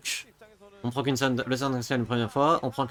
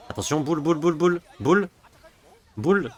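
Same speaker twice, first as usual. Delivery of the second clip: rising, louder calls of boule; excited play-by-play; 5 semitones higher, 5 dB louder than usual. Real, not acted. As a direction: call out boule faster and louder. Casting a live fight.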